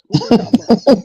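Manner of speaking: high, loud disbelieving shout; shocked yell. Retold or imitated. Reacting to the streamer's words, a loud shocked yell. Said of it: "I'm like".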